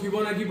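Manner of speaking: Fibonacci said with a hard k, "fibo-NAH-kee"; variant pronunciation. Shown as a demonstration. Teacher showing how some people read it, Fibonacci with hard "-kee". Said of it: "उसके अलग-अलग pronunciation है".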